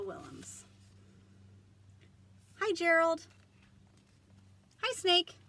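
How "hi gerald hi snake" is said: high, loud, bouncy greeting; cheerful character greeting. Acted. Piggy greeting the snake, high and loud. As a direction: greet your friend brightly.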